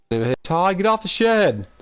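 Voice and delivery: high-pitched shouting at the neighbor; high yell. Retold or imitated. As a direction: call out loud and high. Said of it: "Starts yelling".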